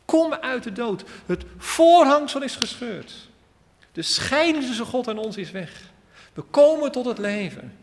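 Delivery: high and loud, calling into the tomb. Acted. Jesus calls Lazarus loudly out of the grave. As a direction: shout it out to raise the dead. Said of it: "roept hij met luider stem".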